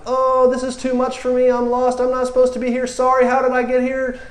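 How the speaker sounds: overwhelmed by what he sees; comic high panic; hammed-up overwhelm. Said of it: "all this manifestation starts to happen".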